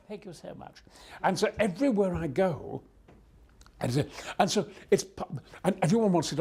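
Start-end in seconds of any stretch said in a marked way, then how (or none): 0.1-0.8 very soft mock-royal voice; tiny, genteel reply; hushed polite thanks. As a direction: murmur a courteous thank-you. Queen's hushed thanks after bellow.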